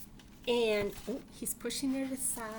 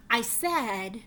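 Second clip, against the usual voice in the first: loud, slow insistence, fed up; bunny losing patience, spelling it out; exasperated bunny; 5 semitones higher, 7 dB louder than usual. Acted.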